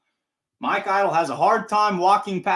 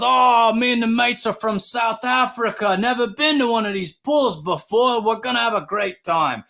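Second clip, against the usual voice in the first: mock South African fan, pitched up; imitating South African accent; 8 semitones higher than usual. Fan telling the announcer where he's from, in a mock South African accent. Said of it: "And I'm hearing this accent".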